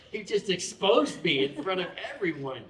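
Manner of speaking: words carried on a laugh. Real, not acted. Pastor laughs about being exposed in class. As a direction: laugh as you admit it. Laughing while telling story.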